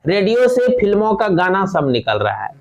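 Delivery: ordinary talk, nothing marked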